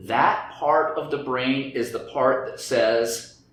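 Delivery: ordinary delivery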